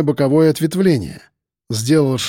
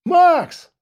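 calling out. A narrator's reading. Voice calling from afar.

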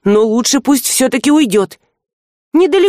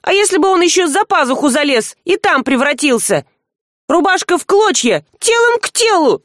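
high, outraged rant at the rat; girl fumes, voice pitched high; indignant scolding; 6 semitones higher than usual. A narrator's reading. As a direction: shoo him off in disgust.

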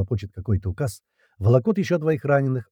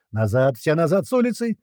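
high-pitched call to retreat; urgent shouted warning; 6 semitones higher than usual. A narrator's reading. Fighters ordering everyone off the street, urgent shout. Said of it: "снова закричали".

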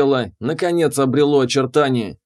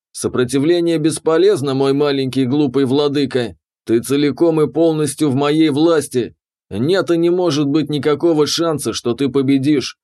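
plain reading voice

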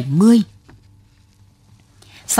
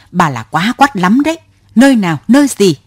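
louder, fast angry outburst; furious; 3 semitones higher, 4 dB louder, faster than usual. Acted. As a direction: fire the questions at her. Driver furious at woman.

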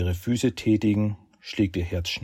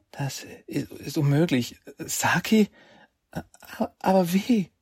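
high, breathless horrified disbelief; tragic heroine sorrow; 10 semitones higher than usual. Acted. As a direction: start on a whisper, then rise in shock. Heroine realises shocking truth.

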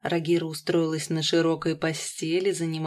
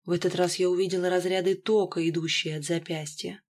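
ordinary delivery

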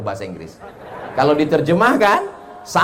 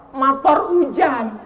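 ordinary talk, nothing marked

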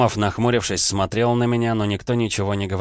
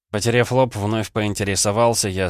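usual speaking voice

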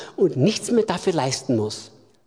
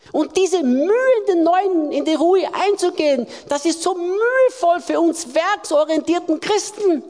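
higher, fervent preaching voice; full-force preaching; 8 semitones higher than usual. Acted. Preacher urging werksorientierte Christen, full-force preaching. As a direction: lift the sermon up and drive it home.

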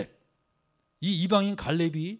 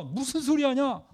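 higher, slow, outraged rebuke; indignant retort; 6 semitones higher, slower than usual. Retold or imitated. Rebuking the crowd, hurt and indignant. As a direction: Joshua and Caleb tearing their clothes, rebuking the people with indignation. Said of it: "찢으면서".

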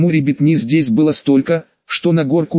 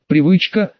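ordinary delivery, nothing marked

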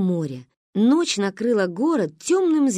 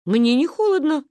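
calm reply; 7 semitones higher, 4 dB louder than usual. Acted. Misha answers, child voice.

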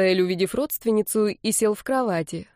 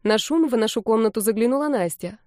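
ordinary delivery

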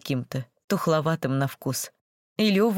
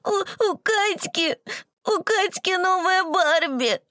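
put-on high, slow tearful child's wail; sobbing little girl; 13 semitones higher, much slower than usual. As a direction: sob it out like an upset girl. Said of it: "сквозь ... слёзные коты".

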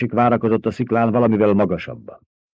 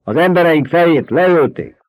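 angry shout; 8 semitones higher, 6 dB louder than usual. A narrator's reading. Angry fighter shouting back.

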